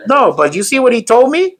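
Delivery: play-acted indignant appeal; childish protest to God. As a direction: whine your case to God. Acts out complaining to God.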